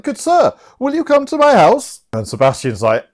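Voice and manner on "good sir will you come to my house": high put-on lady's voice; high, theatrical invitation; mock Olivia. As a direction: invite him in a hammed-up high voice. Voicing smitten Olivia.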